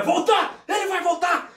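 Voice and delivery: urgent warning shout. Pretend. Player's character shouts a warning to the party. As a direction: warn everyone he is coming back.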